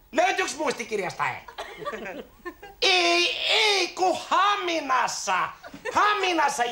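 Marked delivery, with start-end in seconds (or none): 2.8-6.6 high, stretched-out exasperated protest; exasperated correction. Acted. Son corrects father about the summer in Hamina, exasperated correction. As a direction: drag the words out in exasperation.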